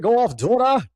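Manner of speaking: high, loud mock hype; imitated cheering on. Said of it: "He basically said".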